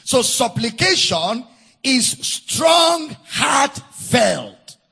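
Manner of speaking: very slow, word-by-word pulpit emphasis; drawn-out, deliberate preaching; emphatic preaching. Acted. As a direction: stretch and weigh each word for the congregation.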